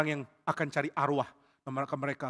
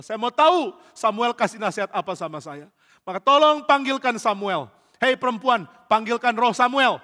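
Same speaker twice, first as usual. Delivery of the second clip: demanding; 6 semitones higher, 7 dB louder than usual. Acted. Saul demanding Samuel summoned.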